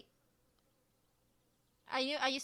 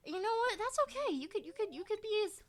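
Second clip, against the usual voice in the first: mock consoling; 9 semitones higher, 5 dB louder than usual. Mocking the fish that escaped.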